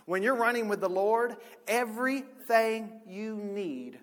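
emphatic preacher building in force; preaching. Real, not acted. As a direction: drive the promise home to a congregation. Preaches at rising full force.

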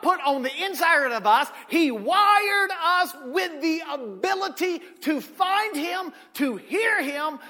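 loud, fervent preaching that climbs high; full-force preaching. Acted.

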